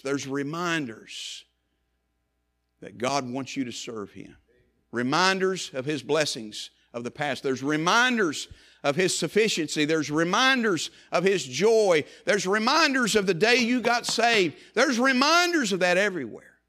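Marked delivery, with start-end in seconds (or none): 4.9-16.6 building preaching. Acted. Preacher to congregation, building to a ringing climax.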